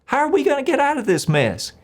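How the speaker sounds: high whiny complaint; whining. Retold or imitated. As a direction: whine it to God, pitch way up. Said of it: "He's whining".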